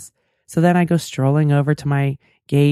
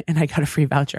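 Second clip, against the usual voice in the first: ordinary delivery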